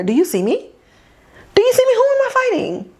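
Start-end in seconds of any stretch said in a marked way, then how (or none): 1.5-2.9 much higher indignant repeat; high, incredulous retelling; mock indignant. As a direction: pitch it way up in mock disbelief. Retold indignant question, repeated higher.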